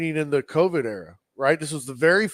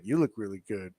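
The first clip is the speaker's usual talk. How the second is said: lightly voiced fighter, lower and softer; hint of a low-key man answering his girlfriend; 7 semitones lower, 8 dB quieter than usual. Podcaster imitating the fighter answering his girlfriend, low-key. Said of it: "And he's like".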